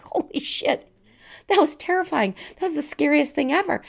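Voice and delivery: high, breathless laughing relief; shaken relief. Retold or imitated. Herself after the crash, high laughing relief. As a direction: blurt it out high, still shaking with laughter. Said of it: "And I'm like".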